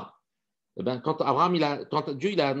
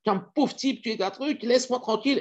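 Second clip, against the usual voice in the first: wife storming out; 11 semitones higher than usual. Acted. Wife slamming the door on husband, high furious voice. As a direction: hurl it at him as she slams the door. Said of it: "elle dit, elle claque la porte".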